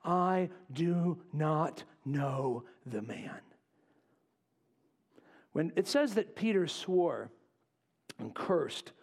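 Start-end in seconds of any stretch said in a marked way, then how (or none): 0.0-3.4 very slow, word-by-word denial; measured, emphatic sworn denial; swearing. Acted. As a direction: space out every word like an oath. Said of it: "to swear".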